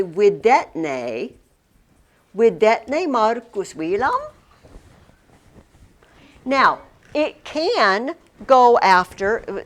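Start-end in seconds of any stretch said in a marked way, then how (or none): 0.0-4.3 widetne Marcus Wilam said slowly, ne rising; slow Latin model. Shown as a demonstration. Teacher modelling the Latin sentence for students, slow and clear. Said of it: "So we would probably say".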